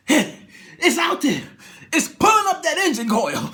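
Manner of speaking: loud, high, frightened yelling; panicked shout. Retold or imitated. Frightened passenger shouting to the pilot.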